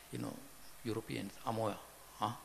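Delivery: usual speaking voice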